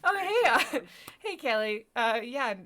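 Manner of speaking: loud, high giddy hello; hysterical greeting. Real, not acted. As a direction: burst out giddy greeting someone. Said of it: "I'm hysterical".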